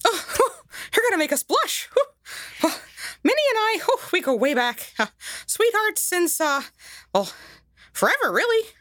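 high, bashful cartoon mouse with nervous laughs; embarrassed high Mickey voice, breathy puffs; flustered Mickey Mouse. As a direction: blush and stammer as Mickey. Mickey flustered about Minnie.

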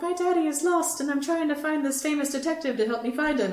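put-on high-pitched little girl pleading; little girl Olivia. Retelling Olivia asking for help, high little-girl voice. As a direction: pitch it up as a lost child. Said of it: "And she's like".

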